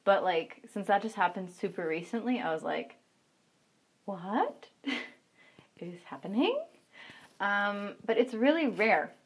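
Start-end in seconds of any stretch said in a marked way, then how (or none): 4.0-6.6 slow, drawn-out bewildered question; retold amused bewilderment; baffled disbelief. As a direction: stretch out the question with a laugh, baffled. Retold bafflement at being blocked.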